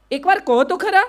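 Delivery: insistent coaxing; urging plea. Retold or imitated. Urging mother-in-law to answer back.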